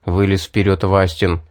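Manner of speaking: usual speaking voice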